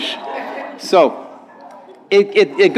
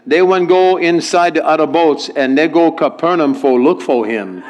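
lilting Hawaiian Pidgin reading. Acted. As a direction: read it out in a Hawaiian Pidgin accent. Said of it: "translation from our English into Hawaiian Pidgin".

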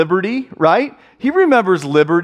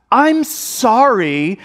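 higher, very drawn-out fake apology; not sorry at all; 5 semitones higher, much slower than usual. Kid forced to apologize for hitting, insincere. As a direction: drag out an apology you don't mean. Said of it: "is not sorry at all".

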